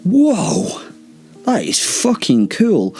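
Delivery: high whoa, then slow gushing; drawn-out, overdone wonder; mock amazement. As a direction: act hugely impressed by a trick. Mock amazement at vampires reappearing.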